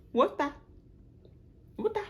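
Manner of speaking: high, loud stunned what-the; shocked disbelief. Retold or imitated. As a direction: react like you can't believe it. Shocked at name change.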